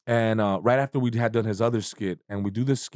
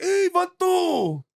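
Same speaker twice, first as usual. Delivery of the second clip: much higher, drawn-out mock tough-guy voice; imitating the angry boxer; 13 semitones higher, slower than usual. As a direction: stretch out Vato like an offended fighter. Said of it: "He's like".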